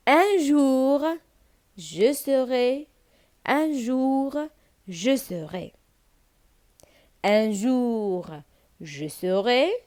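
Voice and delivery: slow refrain with long pauses; poem recitation. Shown as a demonstration. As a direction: model each phrase slowly for pupils. (إلقاء اللازمة بشوية مع وقفات)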